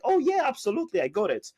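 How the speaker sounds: very high-pitched customer impression; breezy customer. Customer confirms to seller she got the email, breezy customer. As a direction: jump high, then settle, as the easygoing client.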